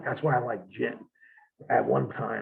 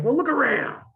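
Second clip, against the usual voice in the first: drunk mocking; 10 semitones higher than usual. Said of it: "they're real loud, obnoxious".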